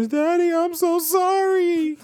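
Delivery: put-on very high, slow childlike apology; imitating sorry son. Dad mimicking his son apologizing from the toilet, high and slow. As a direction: drag it out like a guilty toddler. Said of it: "all I hear is".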